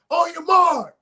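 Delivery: high, loud starter's call; old school race starter. Pretend. Teasing the old friend, a high loud race starter's call. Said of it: "I'm talking old school".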